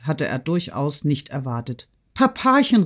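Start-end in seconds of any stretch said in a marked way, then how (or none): 2.1-2.8 high-pitched pleading call; acted weepy plea, pitched high; tearful cry. A narrator's reading. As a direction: call after father in tears. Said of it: "rief er ihm weinend nach".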